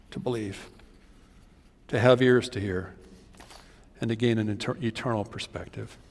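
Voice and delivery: soft, low, earnest plea with long pauses; quiet pleading. Acted. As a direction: plead gently with the lost. Quiet altar-call pleading.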